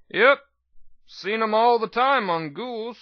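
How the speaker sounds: high, slow, mock grim old doctor; a hint of dark humour in the old medic; grim chuckle. Doctor jokes about ghoul eyes, mild grim chuckle. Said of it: "he said with a grim chuckle".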